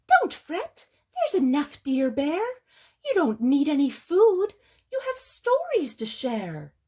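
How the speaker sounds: high, loud little mouse; squeaking mouse. Acted. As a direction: squeak out reassurance like a storybook mouse. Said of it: "Mouse squeaks".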